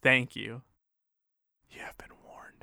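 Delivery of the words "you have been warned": spooky unvoiced whisper. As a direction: breathe the warning ominously. Ominous warning at end of intro.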